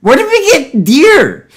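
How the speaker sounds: high, drawn-out baffled cry; incredulous. Real, not acted. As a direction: ask it high and slow in disbelief. Baffled outburst at the story.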